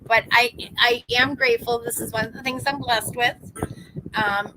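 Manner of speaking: ordinary talk, nothing marked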